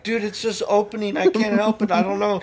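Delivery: high, fake-innocent protest; mock innocence. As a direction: play dumb as the rain pours in. Mock innocent while opening sunroof.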